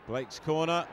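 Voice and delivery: ordinary delivery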